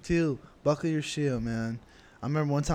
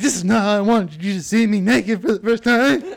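louder, laughing embarrassed plea; laughing through it; 5 semitones higher, 8 dB louder than usual. Retold or imitated. Boy in the shower laughing embarrassed to his crush. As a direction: laugh while confessing. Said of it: "I was like".

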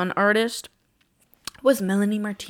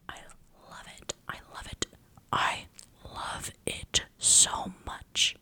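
breathless whispered gushing; excited whisper; 8 dB quieter than usual. Real, not acted. Fan whispers her love for Pitch Perfect, breathy and hushed. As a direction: whisper it like a delicious secret.

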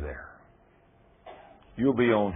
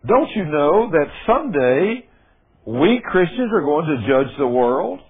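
preaching at full force; 8 semitones higher, 7 dB louder than usual. Acted. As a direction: preach it out to the whole room. Reading scripture at full force.

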